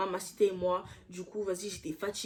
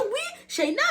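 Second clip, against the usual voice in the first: much higher, sing-song mock of the girl; mock sweet voice; 13 semitones higher, slower than usual. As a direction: parody her sugary tone way up high. Mimicking the girl's fake sweetness. (voix mielleuse moquée, très aiguë)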